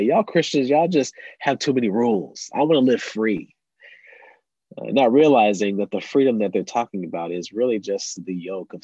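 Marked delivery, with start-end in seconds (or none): 0.0-3.5 higher, louder mock complaint; critical friends. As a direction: imitate friends griping about rules. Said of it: "some of my non-believing friends, I say".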